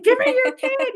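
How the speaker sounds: high, loud mock grabby plea; playful eager demand. Playful plea to exhausted new parents.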